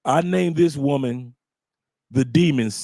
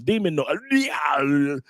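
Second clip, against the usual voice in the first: demon noise; much slower than usual. Retold or imitated. Imitating her demon noises.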